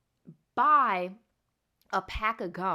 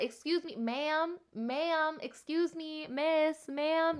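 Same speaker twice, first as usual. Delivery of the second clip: higher, calling after someone politely; imitating store clerk; 7 semitones higher, much slower than usual. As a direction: mimic a clerk calling you back.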